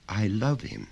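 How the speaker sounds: put-on a child answering simply; small boy. Son answering his father, lightly voiced child.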